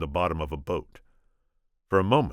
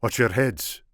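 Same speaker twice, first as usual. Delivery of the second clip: put-on Spanish accent; 7 semitones higher, 6 dB louder than usual. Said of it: "my attempt at Inigo".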